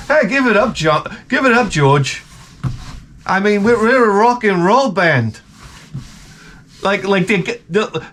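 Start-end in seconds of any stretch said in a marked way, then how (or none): 0.1-5.4 put-on English accent. Beatles dismiss [name]'s song idea, mock English accent. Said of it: "that's my English accent".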